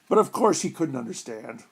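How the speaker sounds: ordinary delivery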